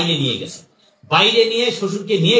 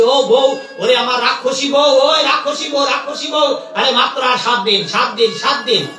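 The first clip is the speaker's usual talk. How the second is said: demon's gleeful shout; 5 semitones higher than usual. Acted. Demon calls his wife from afar, shouting gleefully. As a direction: bellow for the demon wife across the forest. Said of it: "বউকে চিৎকার দিয়ে বলছে".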